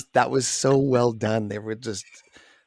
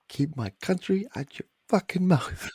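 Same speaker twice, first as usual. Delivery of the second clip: higher, softer quoted comeback; mimicked movie retort; 8 semitones higher, 5 dB quieter than usual. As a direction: imitate a character's retort, pitched up. Quotes the film character's retort.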